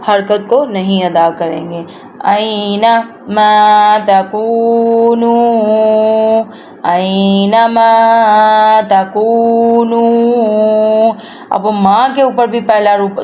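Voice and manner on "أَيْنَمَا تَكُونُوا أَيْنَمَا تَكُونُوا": slow recitation stretching "مَا" and both u sounds of "تَكُونُوا"; elongated vowels. Shown as a demonstration. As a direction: hold the long vowels in "أَيْنَمَا تَكُونُوا". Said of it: "ان کی بھی حرکات کو لمبا کرتے ہیں". ("أَيْنَمَا تَكُونُوا" میں حروفِ مدہ لمبے کر کے)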